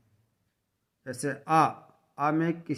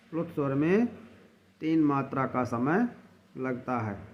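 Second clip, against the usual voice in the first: ordinary delivery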